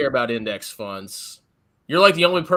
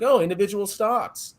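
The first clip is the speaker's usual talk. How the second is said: enticing bait voice; 8 semitones higher than usual. Retold or imitated. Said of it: "I wanna entice them with the carrot, like".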